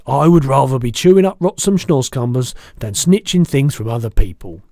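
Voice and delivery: loud, principled giant declaration; proud BFG. A narrator's reading. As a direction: insist loudly on your honour.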